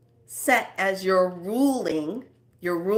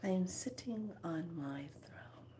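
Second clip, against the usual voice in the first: soft breathy whisper to herself; 14 dB quieter than usual. Shown as a demonstration. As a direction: whisper it like a secret vow. Imagining herself on a throne.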